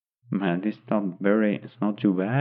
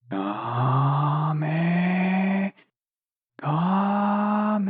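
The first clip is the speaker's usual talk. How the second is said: rising battle cry, far higher on the second Kame; Goku charging his attack; 9 semitones higher than usual. Acted. Goku aiming at the fleeing plane, charging a rising battle cry. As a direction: call it out like a fighter powering up a blast.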